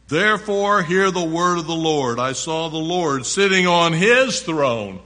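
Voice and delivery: high, loud scripture declaration; preacher ringing out a prophet's vision; prophetic proclamation. A narrator's reading. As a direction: proclaim it, punching the final phrase.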